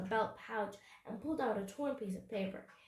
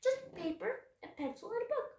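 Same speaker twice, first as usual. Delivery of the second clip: high, small voice; high voice without force; meek boy's answer; 11 semitones higher than usual. A narrator's reading. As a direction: answer the pirate meekly, pitch raised. Boy showing pirate his bag.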